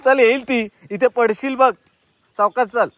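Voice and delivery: calling out. Real, not acted. Coaxing the cat and child.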